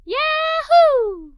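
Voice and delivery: loud, high whoop of joy; excited yahoo. Acted. Girl cheering to her father's offer, loud joyful whoop. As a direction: cheer it out at the top of your voice. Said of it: "I answered as he expected".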